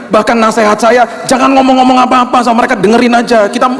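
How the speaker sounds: high, intense preaching voice; impassioned preaching. Acted. Pastor urging mourners' comforters not to talk, impassioned preaching. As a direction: plead with the church.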